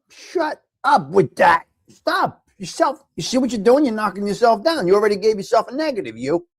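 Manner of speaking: tough-love telling-off; scolding yell. Real, not acted. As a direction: scold her hard for putting herself down. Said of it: "Could I yell at you".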